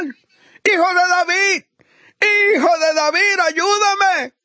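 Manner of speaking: high, drawn-out cry for help; preacher acting a blind beggar's shout. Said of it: "y empezó a gritar".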